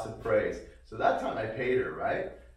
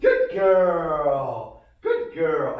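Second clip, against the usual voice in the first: high praise; 5 semitones higher, 11 dB louder, much slower than usual. Real, not acted. Praising dog in training.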